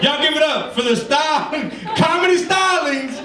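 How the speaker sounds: big laughing introduction of the comic; hype announcer shout. Real, not acted.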